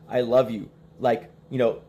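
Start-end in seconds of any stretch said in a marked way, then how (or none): none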